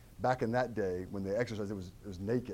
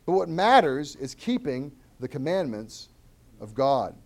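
loud, higher, deliberate reading of the text; scripture reading; 4 semitones higher, 5 dB louder than usual. Acted. Pastor reading scripture to the church, proclaiming. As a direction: proclaim the verses to the congregation.

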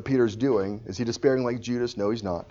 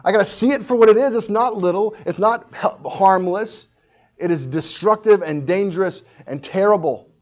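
high, loud, urgent preaching; fervent resolve; 9 semitones higher, 9 dB louder than usual. Acted. A believer resolving to see sin rightly, fervent and loud. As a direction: drive each point home loudly, high-pitched.

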